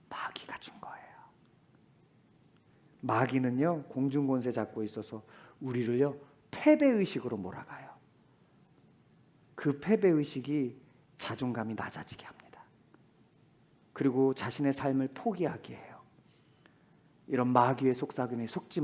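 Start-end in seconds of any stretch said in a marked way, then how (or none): 0.1-1.1 eerie whispered reveal; soft whisper about the devil; hushed whisper. Acted. 11.2-16.0 soft sinister whisper; unvoiced hissing whisper; devil's whisper.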